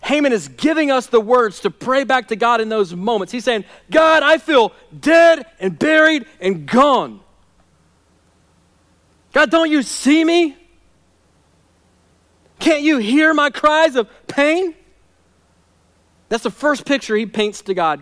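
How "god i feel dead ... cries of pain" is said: preaching a despairing prayer, higher and louder; anguished prayer. Acted. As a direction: cry out to God as a man who feels dead. Voices the psalmist's prayer.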